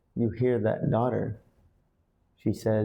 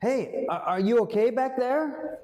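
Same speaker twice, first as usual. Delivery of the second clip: calling to the back; 13 semitones higher than usual. Shown as a demonstration. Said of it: "You say".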